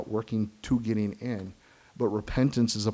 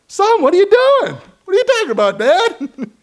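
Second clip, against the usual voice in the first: much higher, loud, laughing mock voices; playful kid-dad dialogue; 11 dB louder than usual. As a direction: ham up the child's denial. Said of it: "you know".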